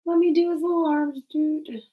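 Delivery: cutesy voice. Real, not acted. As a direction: coo at the tiny arms. Painting little arms.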